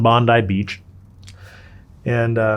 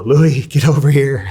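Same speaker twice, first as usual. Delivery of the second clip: louder, slow and urgent call through the wall; freaking out; 5 semitones higher, 7 dB louder than usual. Retold or imitated. Calling uncle next door after the package, urgent freaked-out call. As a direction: hammer the words out in panic. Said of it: "I'm fucking freaking out".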